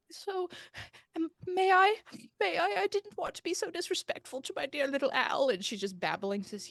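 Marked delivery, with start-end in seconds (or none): 0.1-5.5 put-on high, pleading old woman's voice; pleading Penelope. Penelope begs the medium to confirm the dog forgives. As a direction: plead for reassurance, much higher.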